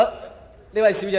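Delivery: ordinary talk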